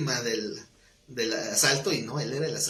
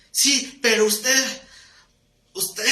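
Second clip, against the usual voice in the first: high, loud, drawn-out mock protest; hammed-up sputtering accusation; angry church member; 7 semitones higher, 6 dB louder, much slower than usual. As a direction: imitate a cornered man groping for a comeback. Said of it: "se enojaba con el pastor".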